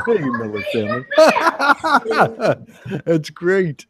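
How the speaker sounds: high laughing greeting. Real, not acted. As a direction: laugh through the words. Cracking up at dancing bear.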